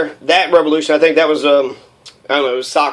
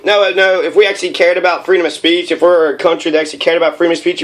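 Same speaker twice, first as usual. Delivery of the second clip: normal talking voice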